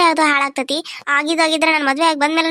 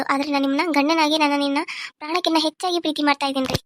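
ordinary delivery

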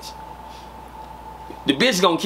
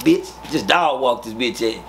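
ordinary talk

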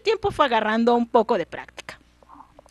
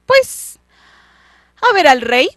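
grito agudo y fuerte de muchacho insolente; suéltalo alto y de mala gana; respuesta grosera; 6 semitones higher, 11 dB louder than usual. Acted. Muchacho grosero responde a la mujer. (grito grosero del muchacho)

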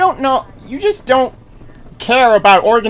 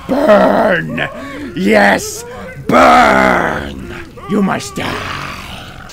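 harsh mock-evil growl, drawn out; villainous gloating; much slower than usual. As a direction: growl it slowly like a gleeful villain. Gloating while burning enemies.

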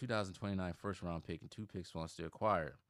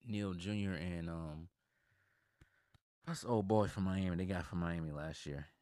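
normal talking voice

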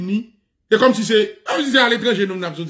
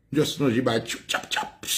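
mock stamp noises; 6 semitones lower, 7 dB quieter than usual. Mimicking stamping sound.

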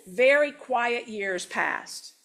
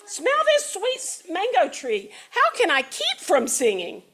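merry; 8 semitones higher than usual. Acted. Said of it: "said the merry Gallito".

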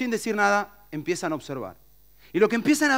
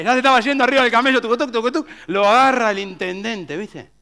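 normal talking voice

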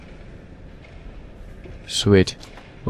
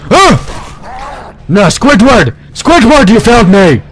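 loud, high despairing yell; anguished shout; 10 semitones higher, 5 dB louder than usual. Real, not acted. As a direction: cry out as your buddy goes down. Yelling at teammate who died.

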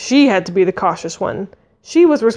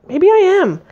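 higher-pitched hopeful wondering; hopeful thought; 9 semitones higher, slower than usual. A narrator's reading. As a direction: let a sudden hope lift the voice. Sudden hopeful thought in narration.

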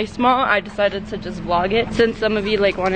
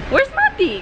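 surprised. Real, not acted. Looking for missing friend.

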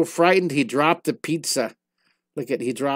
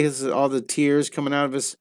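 normal talking voice

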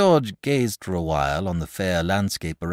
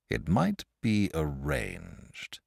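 thoughtfully; 9 semitones higher, much slower than usual. A narrator's reading. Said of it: "said Saint George thoughtfully".

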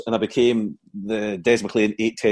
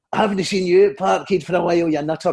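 higher-pitched mock Scottish comedian; Billy Connolly impression; 6 semitones higher than usual. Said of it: "as Billy Connolly and I went".

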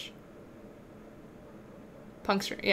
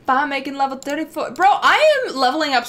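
excited gasp; 5 semitones higher, 6 dB louder than usual. Real, not acted. Thrilled at leveling in game.

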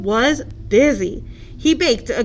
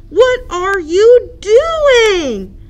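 slow, high and loud amazement; astonished question; 9 semitones higher, 8 dB louder, much slower than usual. Acted. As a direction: drag it out in disbelief. Hippo startled by Duck.

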